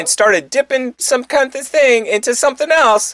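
worked-up rant. Real, not acted. Disgusted rant about the video.

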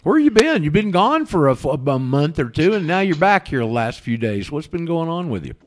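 loud, high hail across room; calling out. Real, not acted. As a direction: call him over from a distance. Calling out to absent friend.

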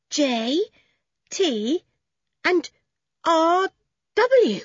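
put-on boy reading letters off the pig, breathless. A narrator's reading. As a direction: spell them out breathless with excitement. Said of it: "said Toby in a breathless voice".

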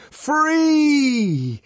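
very high, loud exultant cry; wild liberating shout. A narrator's reading. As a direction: yell it out to the whole mob. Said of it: "the savage shouted".

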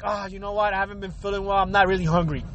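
soft fake excuse. Turning down the host's food with a soft fake excuse. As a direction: beg off the food quietly. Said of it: "Another way is just like".

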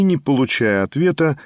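usual speaking voice

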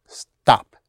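"Stop." clipped short and pushed sharply down in pitch; falling fourth tone. Shown as a demonstration. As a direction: drop "Stop." like a finger jabbed downward. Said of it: "That's the tone. A short staccato push down".

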